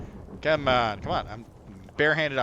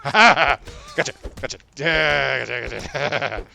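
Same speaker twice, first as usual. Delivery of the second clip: triumphant shout; 3 semitones higher, 10 dB louder, slower than usual. Real, not acted. Landing hits in fight.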